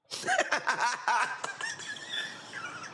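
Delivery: squeaky giggle at own joke; high squealing laugh. Real, not acted.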